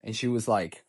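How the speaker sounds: ordinary delivery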